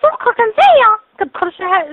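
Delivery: scolding loudly; shouting. Real, not acted. مالك، مالك كتغوتي؟.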